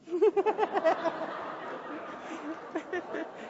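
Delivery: high soft giggle; child's giggle. A narrator's reading. The hiding child giggling on the phone. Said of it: "a little giggle".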